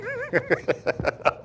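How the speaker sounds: a squeaky real laugh; high giggle; high laughing burst. Real, not acted. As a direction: break up laughing. Preacher breaks into laughter.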